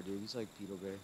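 normal talking voice

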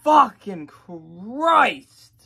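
loud, drawn-out shocked outburst; stunned exclamation. Real, not acted. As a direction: let it explode out slowly, floored. Stunned reaction to the vocals.

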